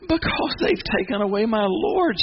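high, pleading grief; heartbroken. Acted. Mary telling the angels her Lord is gone, heartbroken. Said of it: "then hear Mary's heart".

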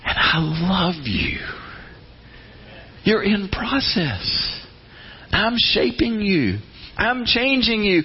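slow, loving, unhurried God; slow, soothing father's voice; tender reassurance. Retold or imitated. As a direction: hold them gently with every word. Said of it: "And God goes".

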